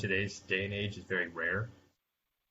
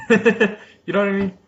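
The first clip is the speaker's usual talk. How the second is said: laughing; 9 semitones higher, 14 dB louder than usual. Real, not acted. Laughing through reply.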